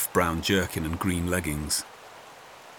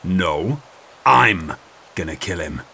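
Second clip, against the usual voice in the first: angry; 4 dB louder, slower than usual. A narrator's reading. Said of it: "Guy turned to glare at him".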